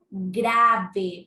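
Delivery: normal talking voice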